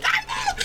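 very high mock shriek; imitated kid battle cry. Little kid attacking grown man, mock battle cry. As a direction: shriek like a kid going wild.

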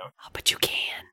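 soft unvoiced whisper. Real, not acted. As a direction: whisper it like a secret. Conspiratorial whispered banter.

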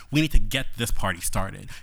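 ordinary delivery

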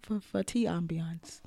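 soft slow whisper. Pretend. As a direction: breathe it like a secret. Whispers a joke about ambiance.

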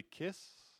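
breathy, hushed one word; barely voiced, soft invitation; soft whisper-like kiss. Girlfriend asking for a kiss, softly hushed. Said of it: "she said softly".

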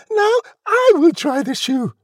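very high, loud pushy demand; yell. A narrator's reading. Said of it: "who yelled".

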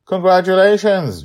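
high, loud delighted cheer; excited congratulations. Acted. Narrator cheers Madeline's Paralympic win, excited. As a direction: burst out with joy for her.

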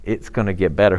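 ordinary delivery

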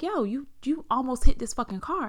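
high, loud imitation of an upset driver; mock upset telling-off; retold upset outburst. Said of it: "So he got upset".

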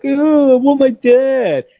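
high, loud mock-whiny child; bratty kid. Podcast host mocking a TV character's son, bratty high voice. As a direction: put on a spoiled kid's high-pitched complaint. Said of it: "Ted Lasso's little bratty kid, like".

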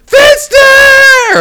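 very high, very loud shout; mimicked yell. Kid calls out for Finster, mimicked high yell. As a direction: yell it out as a drawn cry for help.

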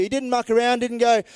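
normal talking voice

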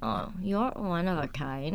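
sarcastic compliment. Shown as a demonstration. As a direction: say it as a backhanded dig. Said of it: "See, it's different".

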